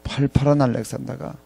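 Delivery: normal talking voice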